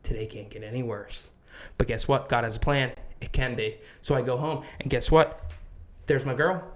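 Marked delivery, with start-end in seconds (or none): 0.0-1.3 breathy curse, then soft and deflated; fired man sinking, soft and low; defeated. Retold or imitated. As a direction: let the air out, beaten. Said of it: "I'm like".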